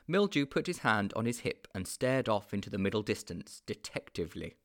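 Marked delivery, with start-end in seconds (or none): none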